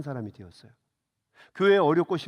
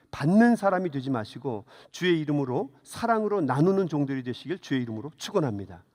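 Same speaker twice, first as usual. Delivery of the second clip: usual speaking voice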